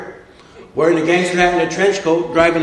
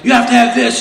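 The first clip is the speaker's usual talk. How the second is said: higher-pitched, insistent mimicry; retold pushy urging; 6 semitones higher, 3 dB louder than usual. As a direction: imitate friends pressing him hard. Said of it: "these two just jump on me".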